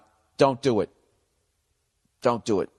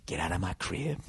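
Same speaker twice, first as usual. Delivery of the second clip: very low, hushed movie quote; movie-line impression; 8 semitones lower, 11 dB quieter than usual. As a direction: drop way down and soft, quoting a film. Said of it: "What movie was that?".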